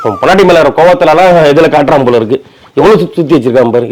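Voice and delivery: normal talking voice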